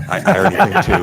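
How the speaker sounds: laughing, voice jumping up high; nervous laugh. Real, not acted. Nervous laugh about second child.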